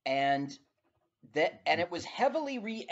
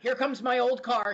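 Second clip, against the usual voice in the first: excited; 8 semitones higher, 4 dB louder than usual. Real, not acted. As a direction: announce it with glee. Excited at his old car.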